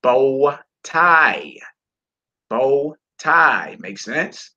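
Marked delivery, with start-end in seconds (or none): 0.0-1.6 Bow tie drawn out in two syllables; pronunciation model. Shown as a demonstration. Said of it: "You would say bow tie". 2.5-3.8 Bow tie as two clear syllables; pronunciation model. Shown as a demonstration. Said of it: "You would say bow tie".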